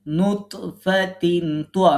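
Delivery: নুতফাতিন তোয়া very slow, with stress on তোয়া; teacher modelling the phrase slowly; slow qalqala demo. Shown as a demonstration. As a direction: stretch out নুতফাতিন তোয়া with a bounce. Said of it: "কলকলা করতে".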